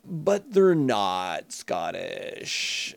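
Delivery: low, slow, flat put-down; a hint of a weary skeptic correcting people; dry deadpan retort. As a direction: say it slowly, dead-pan and unimpressed. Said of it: "I'm like".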